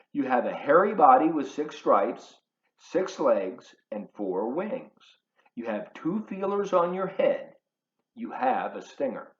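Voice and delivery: ordinary delivery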